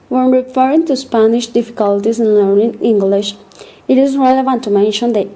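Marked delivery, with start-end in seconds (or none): none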